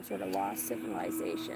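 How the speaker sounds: drops deep for lost civilization; dramatic low voice. Pretend. To viewers, a sudden mock-ominous deep voice. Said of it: "Why did I wanna say it like that?".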